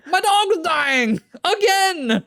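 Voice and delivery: very high, loud, drawn-out cry; mock wail. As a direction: wail it slowly in exaggerated despair. Mock wail about dying game dog.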